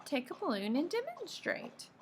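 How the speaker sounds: high-pitched motherly instruction; mother's request, pitched well up; coaxing mother. A narrator's reading. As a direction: prompt a child in a lifted voice. Mother prompts Peter in story.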